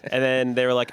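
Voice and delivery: ordinary delivery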